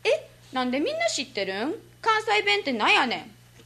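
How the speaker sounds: high-pitched mock bewilderment in Kansai dialect; acted baffled reaction. Exchange student wondering how everyone knows, mock bafflement. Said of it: "だからいつも、".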